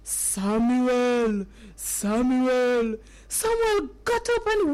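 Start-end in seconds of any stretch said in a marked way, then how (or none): 0.0-3.0 slow, drawn-out low call; God's calling voice. Acted. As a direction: call the sleeper with long, even syllables. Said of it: "He heard the voice again".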